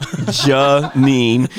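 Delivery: "Janine" stretched out slowly, syllable by syllable; exaggerated pronunciation. Shown as a demonstration. Speaker jokingly obeys a correction, overdone "Janine". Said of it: "say her name properly one time".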